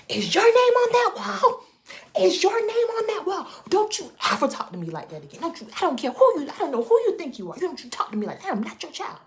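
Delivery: high, loud furious shout; furious yelling. Retold or imitated. She tells her colleague off, high and shouting. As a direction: blow up at him, full voice. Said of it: "I flipped out".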